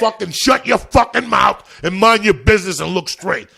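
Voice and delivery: loud, high furious bark; loud, high mock shout telling bystanders to mind their business; retold angry shout. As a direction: yell them into silence. Juan yelling at bystanders.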